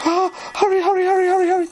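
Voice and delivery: high, urgent repeated plea; gasp, then rush it out high; panicked. Real, not acted. Player hurrying as danger closes in, panicked.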